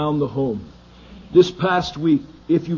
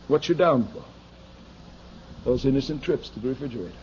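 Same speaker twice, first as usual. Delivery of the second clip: ordinary delivery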